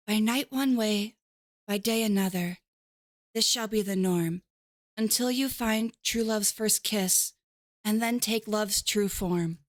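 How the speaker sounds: slow, solemn recitation; reciting a verse. Acted. Fiona reciting her curse to Donkey, solemn. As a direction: intone it like a spell.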